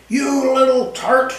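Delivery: high, angry yelling husband; furious high-pitched yell at his wife. A narrator's reading. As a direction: lash out at the betraying wife. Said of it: "I heard Forez yelling".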